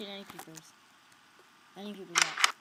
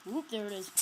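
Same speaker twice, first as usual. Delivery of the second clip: ordinary delivery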